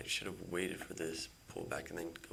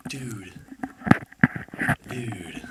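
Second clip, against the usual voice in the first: exasperated dude; 5 semitones higher, 16 dB louder than usual. Real, not acted. Watching trade go wrong.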